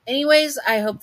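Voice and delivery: a loud, high, sing-song "Anyways"; bright sign-off. Real, not acted. Host cheerfully wrapping up the story for viewers. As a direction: swing into the outro with a big lift.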